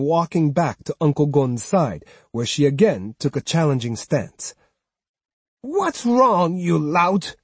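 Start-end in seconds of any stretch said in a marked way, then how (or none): 5.6-7.4 high, scornful cry. Acted. Old woman insulting the swordsman, high scornful cry. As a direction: sneer at him. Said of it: "Osugi cried".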